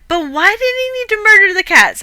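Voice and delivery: high-pitched indignant question; outraged disbelief. Real, not acted. Reader baffled why the hero kills the cats, high and indignant. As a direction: squeak out your disbelief.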